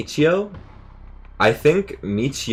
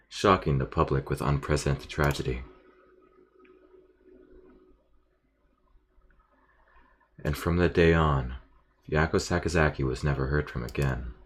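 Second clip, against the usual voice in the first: narrating quietly; 5 semitones lower, 8 dB quieter than usual. Acted. Reading ending narration.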